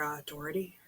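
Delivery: plain reading voice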